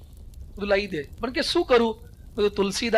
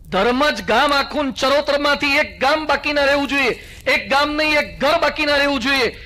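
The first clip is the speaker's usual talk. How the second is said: raised, ringing sermon voice to the crowd; forceful preaching; 3 semitones higher, 4 dB louder than usual. Acted. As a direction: drive the lesson home like a preacher. Rallying call for the festival. (જોશીલું પ્રવચન)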